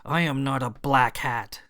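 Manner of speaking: mild growl through the teeth. Acted. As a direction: beast woman growls her denial. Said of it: "She spoke in a growl".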